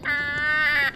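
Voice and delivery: high, held mock "ah"; half-hearted imitation of a pointing screech; imitated scream. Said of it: "can you point at me and go".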